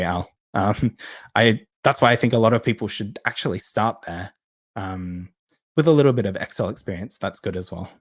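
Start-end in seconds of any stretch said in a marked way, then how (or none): none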